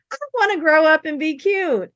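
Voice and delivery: high, loud pleading; playful imitation of young self; mock wistful child. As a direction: wish it aloud like a little girl. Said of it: "And you're like".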